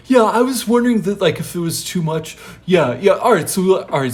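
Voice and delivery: high, loud, hurried agreement; acted flustered acceptance; eager agreement. Jesus hastily accepts Peter's criticism.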